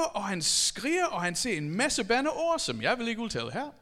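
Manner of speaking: ordinary delivery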